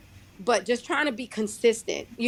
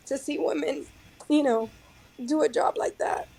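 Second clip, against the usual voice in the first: fighting back tears; 5 semitones higher than usual. Real, not acted. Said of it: "I'm getting emotional".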